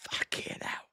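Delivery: real breathless, voiceless whisper; winded whisper. Real, not acted. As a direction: whisper it, knocked back by smelling salts. Reeling from smelling salts.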